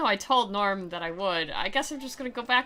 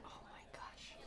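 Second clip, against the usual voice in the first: breathy aside under the breath; hushed, horrified whisper; 27 dB quieter than usual. Real, not acted. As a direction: whisper it in disbelief. Aside at the awful main character.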